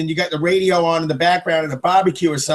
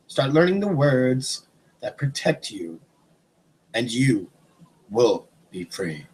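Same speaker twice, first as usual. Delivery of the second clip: hushed, measured motivational speech; solemn exhortation; 5 semitones lower, slower than usual. Real, not acted. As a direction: deliver it like a grave mantra, pausing between phrases.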